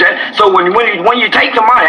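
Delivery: ordinary talk, nothing marked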